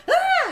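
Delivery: very high, loud little yelp; child's yelp. Retold or imitated. Boy getting his last shot, a high yelp. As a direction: yelp like a kid at a needle. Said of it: "he did go".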